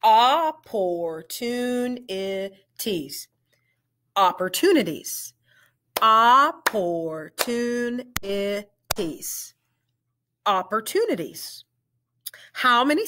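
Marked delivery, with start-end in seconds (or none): 0.0-3.3 syllable by syllable; slow, split into syllables. Shown as a demonstration. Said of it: "We're gonna blend it". 4.2-5.3 blended. Shown as a demonstration. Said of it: "We're gonna blend it". 6.0-9.5 syllable by syllable; slow, split into syllables. Shown as a demonstration. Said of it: "We're gonna blend it". 10.4-11.7 blended. Shown as a demonstration. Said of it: "We're gonna blend it".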